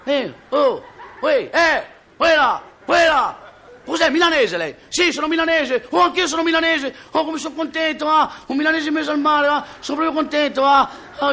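very high, long-carrying holler; comic castaway yelling on one high pitch; calling from afar. Acted. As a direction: shout joyfully to someone far across the water. Castaways hail each other from afar.

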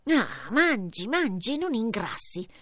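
put-on slow, grumbling old witch; a lightly voiced witch grumbling at Hansel. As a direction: complain under her breath, slowly.

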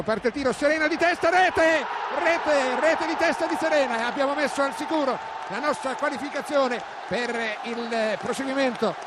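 shouted goal call; breathless italian goal commentary. Real, not acted. Second goal, header by Serena.

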